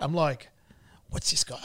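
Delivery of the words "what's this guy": whispered aside. Retold or imitated. Inner thought during the call.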